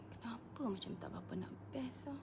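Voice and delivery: a quick whispered worry; whispered aside. Real, not acted. Host whispers a worry about the camera setting. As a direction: whisper it to yourself, unvoiced.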